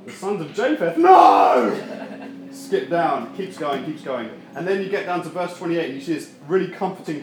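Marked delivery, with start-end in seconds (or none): 1.0-2.5 loud laughing no; exasperated shout. Pretend. Preacher groaning at endless genealogies, loud shout. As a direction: yell it in mock despair. Reaching the sons of Japheth.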